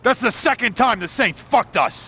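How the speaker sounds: angry shout. Acted. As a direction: blow up at the news. Boss furious after failed job.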